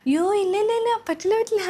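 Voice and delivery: high, urgent no-no-no; alarmed refusal. Acted. New mother hastily refusing to let laundry wait. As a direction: jump up in pitch to stop her at once.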